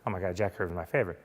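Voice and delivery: hushed, rapid fanboy impression; imitated awestruck fan, soft and fast; Kevin Eastman. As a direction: gush quickly under the voice in awe. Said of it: "Only he said it like Kev".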